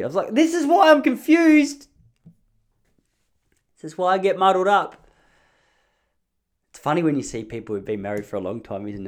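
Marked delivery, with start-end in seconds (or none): 0.3-5.1 exasperated outburst. Retold or imitated. Husband at his wit's end with wife, exasperated high wail.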